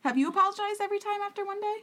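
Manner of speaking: high-pitched, indignant question; incredulous challenge. Acted. Podcaster scolding a woman who won't accept an apology, incredulous.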